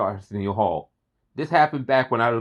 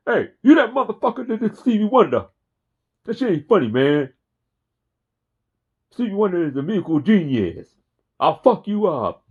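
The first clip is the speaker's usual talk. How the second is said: higher, louder indignant street guy; angry dude impression; 6 semitones higher, 4 dB louder than usual. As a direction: tell him off like an offended fan defending Stevie. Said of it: "he did a impersonation of this dude".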